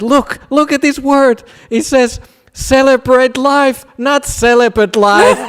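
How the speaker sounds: very high, loud, slowing on the punchline; hammed-up high monk, drawn out slowly; scandalised monk. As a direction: spell out the typo like a disaster. Monk reveals the misprint punchline.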